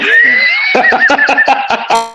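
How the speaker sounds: high burst of laughter; laughing at his own flub. Real, not acted.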